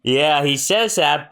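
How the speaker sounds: usual speaking voice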